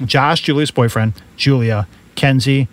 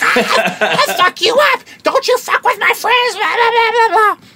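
a far higher, hammed-up drunk girl screeching threats; mock shrieking drunk friend. Drunk friend losing it at the bar, mock high screech.

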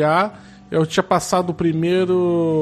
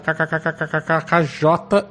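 typed laugh spelled out; slower than usual. Acted. Reads typed laughter letter by letter.

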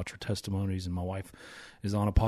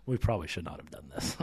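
sighing aside; 4 semitones lower, 6 dB quieter than usual. Real, not acted.

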